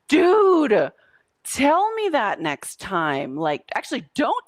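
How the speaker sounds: sudden loud high dude; shocked and loud, real disbelief; stunned dude. Retold or imitated. Coach retells her shocked reply to a client, loud and high.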